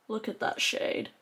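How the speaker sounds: awed hush. Real, not acted. Vlogger admiring the shade up close, hushed awe.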